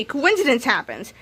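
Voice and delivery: usual speaking voice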